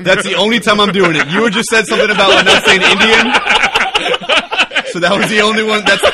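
laughing. Real, not acted. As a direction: laugh your way through the excuse. Laughing while defending the joke.

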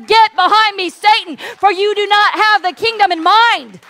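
forceful declaration; rising rebuke. Acted. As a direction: rise up and order him off. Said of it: "Jesus rises up and he says".

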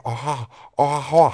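"au revoir" mumbled "o hoha", as if a hot potato is in the mouth; "au revoir" as Aachen "o-ho-ha", mouth round a hot potato. Shown as a demonstration. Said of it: "heiße Kartoffel im Mund".

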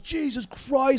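high, drawn-out mock yell; imitated crowd yelling. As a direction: stretch it out like an appalled moviegoer. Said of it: "like, yelling".

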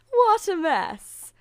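high, loud, drawn-out jeer; mocking stepsisters. A narrator's reading. Stepsisters laughing at grimy Cinderella, mocking. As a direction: scoff loudly, stretching the words. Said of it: "her two stepsisters laughed".

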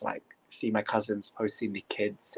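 ordinary delivery

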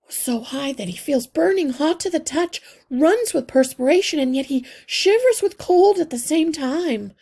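high, loud, hurried and close to panic; frantic mother mouse. A narrator's reading. Mother mouse telling the healer her son is delirious, high and panicked. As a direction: pour out the fear for your sick child. Mother describes son's symptoms.

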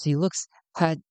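normal talking voice

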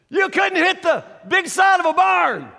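loud, high heckling shout; yelling a cocky taunt; mouthy taunt. Retold or imitated. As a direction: jeer at the big athletes from the booth. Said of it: "I start saying things like".

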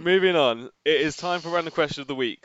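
loud, bright host intro; upbeat announcement. Real, not acted. Host to co-host, launching the weekly question, loud and upbeat. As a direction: announce the new segment with energy.

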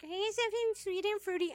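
lightly voiced customer asking for a sweet drink; customer's request. Customer asks the bartender for something fruity, mock girly request.

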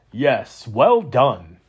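loud, a proud proclamation; proclaimed. A narrator's reading. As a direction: announce it with pride. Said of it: "Kane Lundgren proclaimed".

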